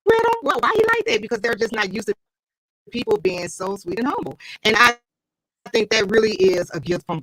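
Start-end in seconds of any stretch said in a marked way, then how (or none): 0.0-1.2 higher, puzzled mock question; puzzled onlookers. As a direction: play the baffled stranger asking about him. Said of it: "people be like".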